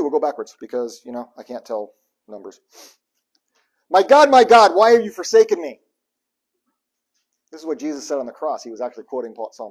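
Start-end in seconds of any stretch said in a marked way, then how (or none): 3.9-5.8 loud, high cry of despair; anguished cry. Acted. Preacher voicing David's desperate cry to God, loud anguish.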